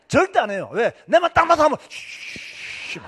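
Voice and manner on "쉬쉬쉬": long hiss of a room going hushed; imitated hushing. 친척들이 조용해지는 소리 흉내. (길게 쉬쉬 소리 흉내)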